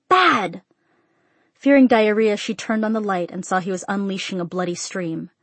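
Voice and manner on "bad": high yell at the dog; urgent one-word reprimand; scolding yell. A narrator's reading. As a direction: scold the dog in a raised, higher voice. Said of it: "she yelled".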